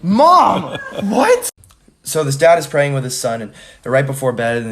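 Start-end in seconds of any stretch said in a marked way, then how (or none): 0.0-1.5 loud high yell at mom; shocked yell. Real, not acted. Caught by mom, yelling.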